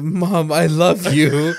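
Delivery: slow, laughing mock declaration of love; teasing a friend, mock-tender I love you. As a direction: overplay the sweet son. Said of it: "and say".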